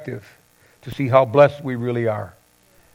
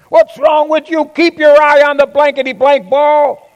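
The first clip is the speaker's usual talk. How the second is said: loud, much higher mock yell; yelling father; 12 semitones higher, 6 dB louder than usual. A father in a retold story yells at his son over a strikeout, mock yell. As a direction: play the angry dad yelling from the stands. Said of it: "he starts yelling at his son".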